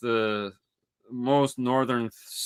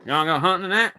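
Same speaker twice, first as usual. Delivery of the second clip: put-on higher-pitched Southern country-boy caricature; mock Southern accent; 6 semitones higher, faster than usual. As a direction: ham up a Southern hunting buddy. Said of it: "They don't speak like he speaks".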